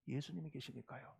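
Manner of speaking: very soft, low repeat; barely voiced, low and soft; hushed reassurance. Real, not acted. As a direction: let the answer sink in, very quietly. Answer repeated much more softly.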